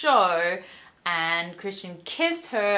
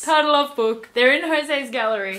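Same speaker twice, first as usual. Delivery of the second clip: ordinary delivery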